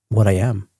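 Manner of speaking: ordinary talk, nothing marked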